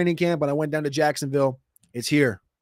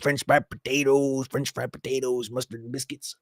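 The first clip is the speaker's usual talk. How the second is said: Sling Blade impression; 4 semitones lower, 4 dB quieter than usual. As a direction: do the Sling Blade guy asking for potatoes. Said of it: "the movie reference, Sling Blade".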